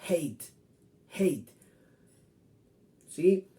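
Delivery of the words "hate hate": hate with a clear ei sound, not Spanish a; English hate. Shown as a demonstration. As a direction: glide hate as eit. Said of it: "no se pronuncia A, se pronuncia". (hate con sonido ei, no a)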